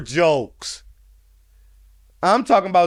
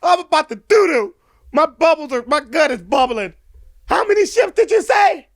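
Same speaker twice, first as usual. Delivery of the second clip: higher and louder mock captain about to soil himself; panicking captain; 10 semitones higher, 5 dB louder than usual. Viewer replaying the joke's captain, loud hammed-up panic. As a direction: ham up the scared captain's panic.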